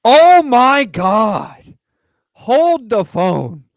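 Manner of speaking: loud, slow stunned disbelief; shocked exclamation. Real, not acted. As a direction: react like the pick is unbelievable. Shocked by surprise pick.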